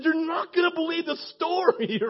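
high-pitched eager exclamation; worked-up, high-voiced retelling; excited announcement. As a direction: burst out with big news. Retelling his news to a pastor.

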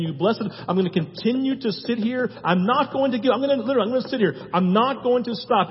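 loud, high, insistent nagging; persistent pestering. Acted. Preacher voicing a stubborn pray-er, loud and insistent. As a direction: keep banging on the door, relentless.

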